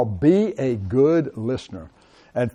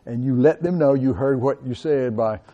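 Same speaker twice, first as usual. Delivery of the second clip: normal talking voice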